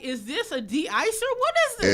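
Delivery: rising, high-pitched baffled question; puzzled surprise. Retold or imitated. Guest puzzled by highway de-icer lines, surprised. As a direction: sound amazed by something strange. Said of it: "And I'm like".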